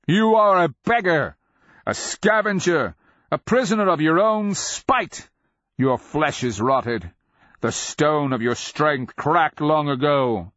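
loud, high-pitched hurled insults; shouted taunt. A narrator's reading. Cleric goading the beast's pride, shouting taunts. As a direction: shout it as a ringing denunciation. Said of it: "Cleric shouted".

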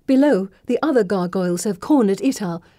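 ordinary delivery

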